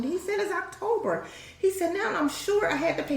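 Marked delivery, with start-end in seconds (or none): 0.4-1.3 high-pitched mock protest; retold indignation. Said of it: "He was so mad". 1.9-3.2 imitating a mad customer, pitched high; high, exasperated retold complaint; retold indignation. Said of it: "He was so mad".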